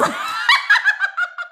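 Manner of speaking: high, loud laughing shriek. Real, not acted. Joking with chat about poles, shrieking laugh. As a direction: burst into a squealing laugh.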